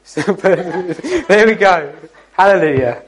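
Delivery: laughing through the words. Real, not acted. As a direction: chuckle along with the audience. Amused exchange with congregation.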